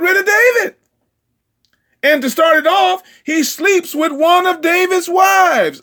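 loud, high, driving sermon delivery; full-force preaching. Acted. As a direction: preach it hard, building the story.

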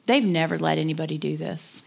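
low-pitched retold nurse; lightly voiced nurse, dropped in pitch; nurse's confiding remark. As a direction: imitate a nurse's low aside. Nurse's aside retold in story.

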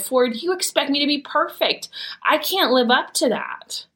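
high-pitched, emphatic acted protest; exasperated plea. Mom tells her son she can't be perfect, exasperated plea. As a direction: plead with him, voice pitched well up.

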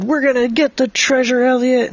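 high-pitched boyish eagerness, acted; rally your friend in a pretend fight; excited battle cry. Boy's battle cry in story.

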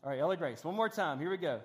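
ordinary talk

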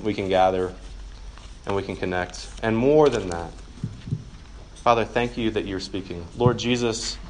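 hushed campus minister's prayer; closing prayer. Acted. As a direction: pray it gently over the room.

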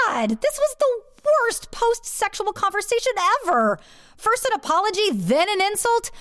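high-pitched indignant disbelief; exasperated outburst. Retold or imitated. Woman reacting to her lover's insult, exasperated. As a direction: let it burst out high and fed up.

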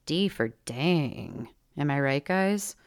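low, drawn-out deadpan gag; mock stand-up aside to the audience; wry joke. A narrator's reading. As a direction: drag out the punchline, dropping low. Wry joke on a bad grade.

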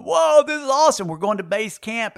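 high, thrilled exclamation; retelling his excitement at heading to base camp; excited whoa. Retold or imitated. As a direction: gush like a kid arriving at camp. Excited climber quoting himself.

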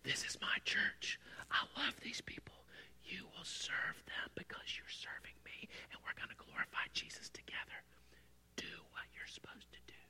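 very soft, deliberate whisper; an inner voice whispering firm guidance; whispered Holy Spirit. Retold or imitated. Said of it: "the Holy Spirit was like".

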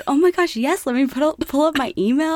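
high-pitched, gushing eagerness, retold; ecstatic. Customer to drive-thru girl about flyer numbers, retold ecstatic gushing. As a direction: act out your own overexcited scramble. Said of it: "[name] was ecstatic".

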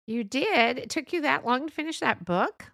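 high, loud mock disbelief; incredulous put-down. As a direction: play a friend scornfully amazed at slowness. Friend belittles her reading speed.

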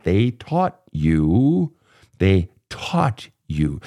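very slow, each word weighed; deliberate slow repetition to drive the point home; slow emphatic repeat. Real, not acted. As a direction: drag it out so it sinks in. Hammering home a point to client.

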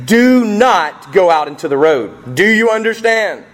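loud, stern parent laying down the rule; strict parent warning a child. Retold or imitated. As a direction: warn your kid, firm and final. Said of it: "It's my parent voice".

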